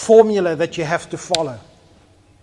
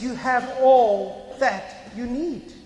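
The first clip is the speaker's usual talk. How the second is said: slow tender repeat; 7 semitones higher, much slower than usual. Real, not acted. Preacher slowly repeats the promise as Jesus would. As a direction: draw it out gently. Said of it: "second take".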